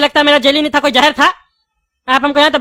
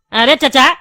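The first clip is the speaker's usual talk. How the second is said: ordinary delivery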